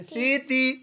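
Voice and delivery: high-pitched syllables. Shown as a demonstration. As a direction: model it high for the pupil. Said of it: "ऊंची आवाज में".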